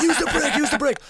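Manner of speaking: high shouted warning, said twice; retold shouting. Instructor yelling at the learner driver, high and shouted. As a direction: yell it at the learner in alarm. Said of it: "He starts shouting at me".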